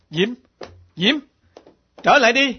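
loud, high call after her. Acted. Hải calling Diễm back as she leaves. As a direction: shout for her to come back.

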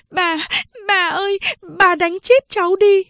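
high tearful pleading, rising in volume; crying while speaking. A narrator's reading. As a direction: sob it out to grandmother. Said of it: "vừa khóc vừa nói". (vừa khóc vừa van bà, giọng cao)

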